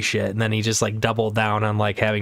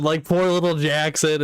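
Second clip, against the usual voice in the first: ordinary delivery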